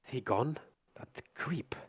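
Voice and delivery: hushed whisper from hiding. Acted. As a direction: peek round the corner and whisper. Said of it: "she goes".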